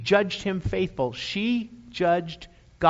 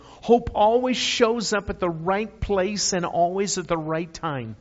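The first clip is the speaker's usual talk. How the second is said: 1 preaching climax; 4 semitones higher, 5 dB louder than usual. Acted. Preaching at full force.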